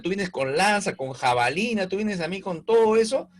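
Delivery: voz alzada y desafiante; David plantándole cara a Goliat, más agudo y fuerte; David desafiando. Acted. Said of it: "Le dice a Goliat". (David desafía a Goliat, voz alzada y firme)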